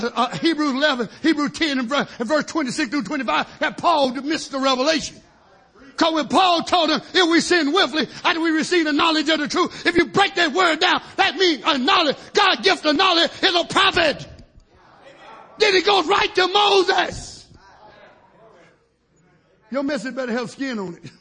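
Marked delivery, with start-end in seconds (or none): 6.0-17.5 climbing higher and louder into a preacher's shout; rising sermon preaching. Acted. Preacher to his church, building into a shout. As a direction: drive the point home to the congregation, building.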